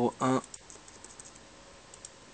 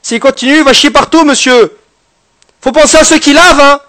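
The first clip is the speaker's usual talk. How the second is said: loud, high pompous doctor; mock doctor voice; 8 semitones higher, 7 dB louder than usual. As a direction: announce it like a hammy doctor. Mock doctor diagnosis, shouting. (voix de docteur outrée)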